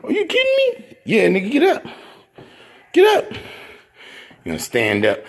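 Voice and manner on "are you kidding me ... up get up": mock confrontation. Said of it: "Told you to".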